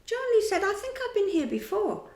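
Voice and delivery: put-on high, small child's voice; a little boy unsure of himself. Michael puzzled, asking his big brother in a child's voice.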